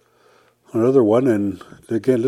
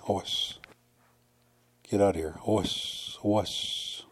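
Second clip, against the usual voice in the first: hushed shoo; 6 dB quieter than usual. Shown as a demonstration. Shooing someone away.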